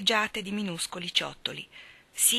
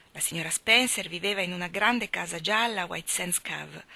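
ordinary delivery, nothing marked

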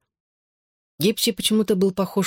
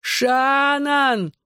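very high, long-drawn call; yelling; 11 semitones higher than usual. A narrator's reading. Boy calling his girl from hospital bed, drawn-out yell. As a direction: holler the name, stretching the first vowel. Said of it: "заорал я во всю мощь лёгких".

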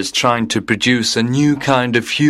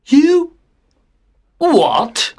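high-pitched, outraged exclamation; shocked shout. A narrator's reading. Father learns mother used tablets, shocked shout. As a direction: cry out in disbelief. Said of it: "Derek shouted".

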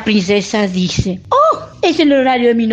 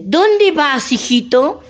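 higher, sly witch voice; 6 semitones higher, slower than usual. Acted. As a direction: tease the prince like a witch. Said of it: "Y esa bruja le dice".

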